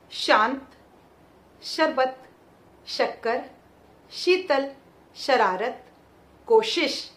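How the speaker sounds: each word alone, श sounded at the palate; palatal sh demo. Shown as a demonstration. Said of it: "शब्द बोलकर देखते हैं". (तालु से साफ़ श का उच्चारण)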